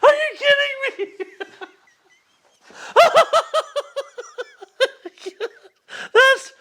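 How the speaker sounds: high-pitched laughing, gasping words; can barely talk for laughing; laughing hard. Real, not acted. Helpless laughing fit at own joke.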